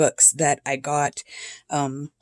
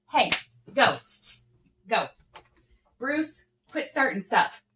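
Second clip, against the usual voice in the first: scolding cats; 6 semitones higher, 8 dB quieter than usual. Real, not acted.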